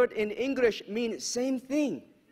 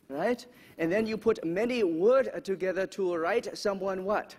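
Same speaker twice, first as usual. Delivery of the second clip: put-on broken-English Japanese accent; bad Japanese accent. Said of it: "my very bad Japanese accent".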